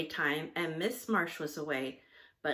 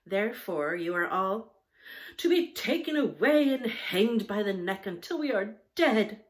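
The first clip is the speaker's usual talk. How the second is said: louder, higher mock sentence of doom; 3 semitones higher, 7 dB louder than usual. Judy announcing the punishment to the children, hammed-up loud. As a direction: pronounce doom like a hammy judge.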